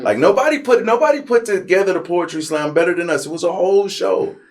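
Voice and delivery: high, loud hyped-up boasting; hyped bragging. Real, not acted. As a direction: pump it up like you crushed it. Bragging about their slam performance.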